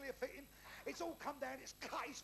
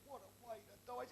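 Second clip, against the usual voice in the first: mock hushed dismay; 3 semitones lower, 7 dB quieter than usual. Mate mourns the friend.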